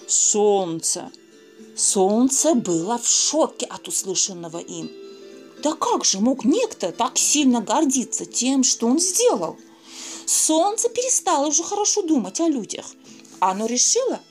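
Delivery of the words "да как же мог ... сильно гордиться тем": ordinary delivery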